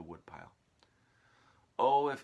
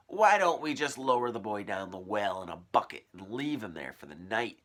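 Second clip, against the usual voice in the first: louder gloating suggestion; suggested; 8 dB louder than usual. A narrator's reading. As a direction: relish the cruel idea. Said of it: "she suggested".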